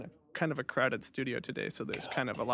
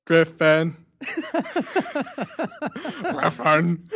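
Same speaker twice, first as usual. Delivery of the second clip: laughing; 3 semitones higher, 9 dB louder, much slower than usual. Real, not acted. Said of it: "I'm your narcissistic host".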